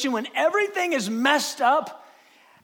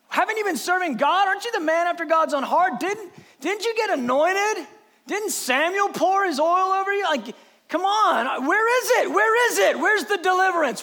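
higher, rapid-fire badgering questions; taunting critics; 7 semitones higher, 4 dB louder than usual. David's own men demanding where his deliverance is, badgering. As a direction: hammer him with one incredulous question after another.

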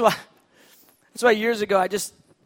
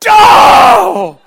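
a huge high NO; much louder, higher mock protest; emphatic shout; 12 semitones higher, 20 dB louder than usual. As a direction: yell the denial full force. Acted denial in imagined chat.

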